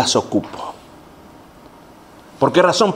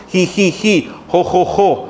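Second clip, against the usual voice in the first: imitated holy laughter in even, separate syllables; forced fake laugh; 2 semitones higher, slower than usual. Pastor shown how to fake holy laughter, mock mechanical laugh. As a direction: mechanical ji-ji-ji, jo-jo-jo, badly faked. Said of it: "este movimiento de la boca".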